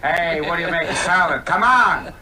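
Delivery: hammed-up English Caesar. Mocking old Roman movies, loud English Caesar yelling. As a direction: yell like a pompous English Caesar. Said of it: "The old Roman movies always had the English accent".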